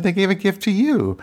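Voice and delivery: high-pitched retold protest; indignant retort. Wife retelling her comeback to her husband about the gift, indignant. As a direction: pitch it way up, correcting him.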